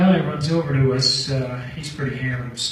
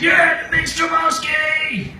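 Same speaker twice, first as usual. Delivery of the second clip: much higher, slow, drawn-out cheer; drunk stranger; 14 semitones higher, 6 dB louder, slower than usual. Retold or imitated. Drunk stranger shouting his name at the ATM, high and drawn out. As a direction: bellow his own name like a hammered guy.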